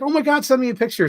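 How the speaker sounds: high, fast, eager squeal; excited friend. Retold or imitated. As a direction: beg for it excitedly. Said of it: "And she's like".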